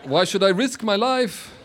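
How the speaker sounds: Niki Lauda impression. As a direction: play Niki Lauda brushing off the question. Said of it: "two Niki Laudas".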